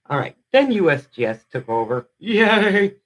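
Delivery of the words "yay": mock cheer. Real, not acted. Mock cheer as USGS takes over.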